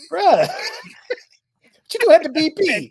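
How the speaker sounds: high, slow, laughing greeting; laughing surprise. Retold or imitated. Running into Terrion at a gas station, high laughing call. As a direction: bust out laughing as you call to him.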